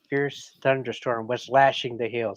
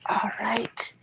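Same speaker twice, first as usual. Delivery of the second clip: faint, breathy whisper from a sickbed; hoarse whisper; 9 semitones higher than usual. A narrator's reading. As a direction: whisper it weakly, feverish. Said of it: "whispered Elizabeth hoarsely".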